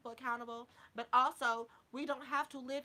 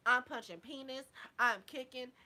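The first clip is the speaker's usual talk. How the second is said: usual speaking voice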